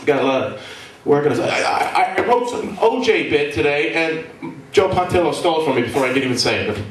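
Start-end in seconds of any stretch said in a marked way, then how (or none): none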